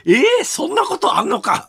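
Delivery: stunned, high exclamation; surprised shout. Real, not acted. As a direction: blurt it out in disbelief. Shocked at building swaying. (驚きの叫び)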